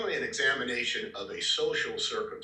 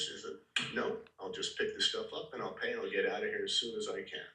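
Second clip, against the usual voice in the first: ordinary talk, nothing marked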